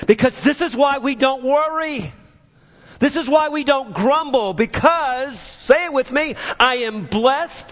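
high, emphatic preaching declaration; with conviction. Real, not acted. Preacher rallying the congregation against worry and grumbling, with conviction. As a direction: proclaim it with full conviction. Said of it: "say it with conviction".

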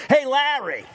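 high, loud holler of a name; calling out. Retold or imitated. Sick man calling his brother over from the window, hollering. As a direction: call across the room to him. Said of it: "he's hearing all this, and he goes".

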